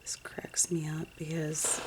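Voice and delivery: soft amused whisper; whispered aside. Real, not acted. Remembering her early stamping days, a whisper. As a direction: breathe it with a private chuckle in the voice.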